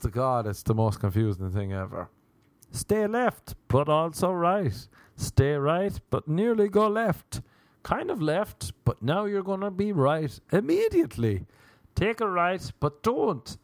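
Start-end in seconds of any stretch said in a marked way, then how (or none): 2.7-13.6 high-pitched mock navigation directions; chirpy parody of a satnav. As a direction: give confusing directions in a high app voice.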